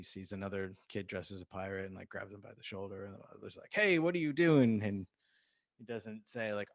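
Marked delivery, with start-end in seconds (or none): 3.7-4.8 higher, louder indignant challenge; acted protective outburst; alarmed mother. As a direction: imitate a mother confronting a stranger. Alarmed mother confronts a stranger.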